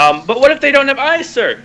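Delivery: high, hesitant soldier's question; nervous recruit questioning his captain; timid soldier. Acted. Soldier asking the captain, high and hesitant. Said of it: "a voice from the side of him go".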